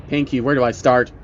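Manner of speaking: ordinary delivery, nothing marked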